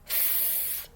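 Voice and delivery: f as a long voiceless hiss; f sound. Shown as a demonstration. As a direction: teeth on the lip, blow f. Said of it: "And the F says".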